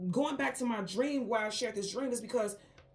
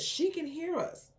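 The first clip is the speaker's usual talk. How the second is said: higher, slow quoted voice; retold eerie voice; 8 semitones higher, slower than usual. Unseen being talking about her, in a high, slow voice. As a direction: draw it out high, as an overheard being.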